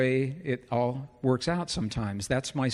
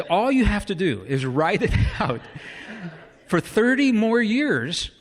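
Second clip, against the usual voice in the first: laughing; 5 semitones higher than usual. Retold or imitated. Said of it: "When he said".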